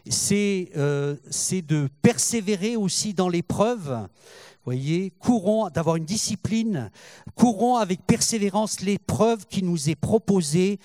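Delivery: loud, rising preacher's exhortation; fervent preaching. Acted. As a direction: drive the congregation onward, full voice.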